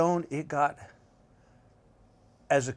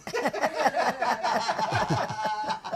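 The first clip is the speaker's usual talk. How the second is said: laugh. Real, not acted. Guest laughs at his own punchline.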